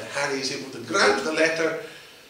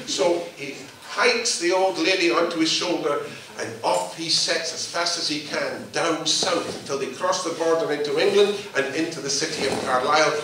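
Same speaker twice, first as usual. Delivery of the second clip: usual speaking voice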